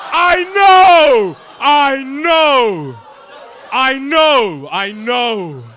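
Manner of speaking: loud, high "I know!" fading down; shouted laughing. Real, not acted. Reacting to the crowd, shouting then fading.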